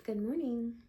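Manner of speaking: high, loud sing-song good morning; bright greeting. Acted. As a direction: greet the camera with big cheer. Cheery greeting to the camera.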